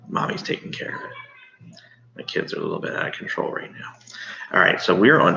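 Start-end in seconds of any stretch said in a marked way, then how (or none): 0.1-3.9 hushed fast aside; whisper. Real, not acted. Aside to class about kids.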